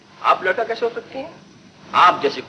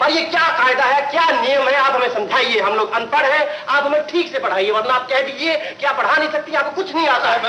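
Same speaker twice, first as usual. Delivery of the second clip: defiant challenge; 6 semitones higher than usual. Acted. Pupil challenges teacher loudly.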